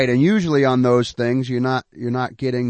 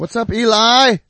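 shout-out; 10 semitones higher, 9 dB louder than usual. Real, not acted. Giving a listener a shout-out.

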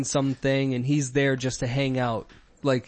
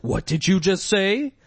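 mock offended; 6 semitones higher than usual. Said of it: "she's all mad".

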